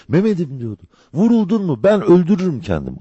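high, urgent anguished pleading; alarmed cry. Acted. Wife to her wounded husband, high and frantic. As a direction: cry out in alarm, pitch leaping up. Hatice sees Mehmet shot.

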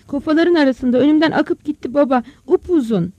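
put-on loud, high, agitated boy; alarmed report. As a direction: blurt out what scared you. Frightened boy reports snake to father. (telaşlı, yüksek sesle anlatma)

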